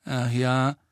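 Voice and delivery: mildly doubtful question; sceptical. A narrator's reading. Harry doubts Hermine's cheering news, sceptical. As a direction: answer as if unconvinced. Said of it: "erwiderte Harry skeptisch".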